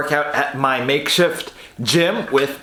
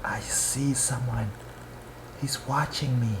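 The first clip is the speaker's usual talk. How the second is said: whisper; 5 semitones lower, 13 dB quieter than usual. Real, not acted. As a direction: whisper so he won't hear. Whispering about watching dog.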